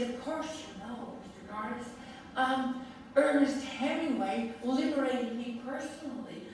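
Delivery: a hint of an elderly American lady's voice; gentle American accent recalling Sylvia Beach. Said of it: "in her American accent".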